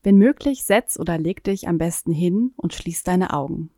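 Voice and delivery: calm guided meditation voice; sleep story narrator. Acted. Guided breathing, eyes closed.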